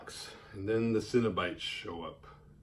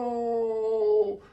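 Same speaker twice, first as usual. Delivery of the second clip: anguished cry; 11 semitones higher, 3 dB louder than usual. Retold or imitated. Box thrown through the window.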